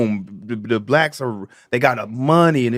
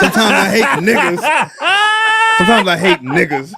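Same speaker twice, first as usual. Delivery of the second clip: laughing; 6 semitones higher, slower than usual. Real, not acted. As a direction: laugh through the words. Laughing outburst.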